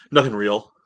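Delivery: ordinary delivery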